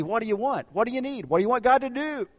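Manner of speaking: exasperated demand for specifics; insistent questioning. Acted. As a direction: press each question harder, pitch climbing. Acted exasperated questioning of vague request.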